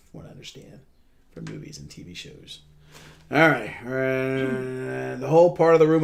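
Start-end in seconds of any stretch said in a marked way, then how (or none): 0.1-2.6 whispered aside. Real, not acted. Trailing aside.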